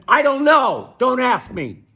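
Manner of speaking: ordinary delivery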